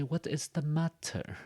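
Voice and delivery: high, slow British English with a crisp T in "matter"; British accent. Shown as a demonstration. Teacher showing how British English says it, British accent. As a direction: pronounce "matter" the British way, with a real T. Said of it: "So in British English".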